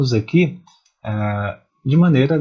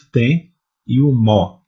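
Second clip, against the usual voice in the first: "tem" and "mó" said slowly and louder; slow stressed syllables; 5 dB louder, slower than usual. Shown as a demonstration. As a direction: tap out the tonic syllables one by one. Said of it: "O pá". (sílabas tônicas ditas devagar e com força)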